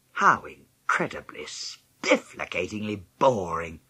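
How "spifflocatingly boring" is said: ordinary delivery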